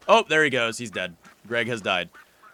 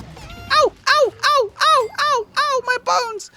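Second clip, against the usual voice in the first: very high, louder squeaky "ow, ow, my bones"; mock pained yelps; 8 dB louder, much slower than usual. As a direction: squeal in cartoon pain. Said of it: "the first time it was".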